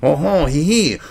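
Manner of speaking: mock Frenchman with a Spanish-sounding accent; badly faked French accent; attempted French accent. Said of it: "I'm going to a Spanish fucking accent".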